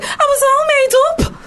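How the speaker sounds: put-on high, slow Liverpool kid voice; a Liverpool kid saying she's all made up; Scouse kid. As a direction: drawl it out in a bright Scouse lilt. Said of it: "then she was like".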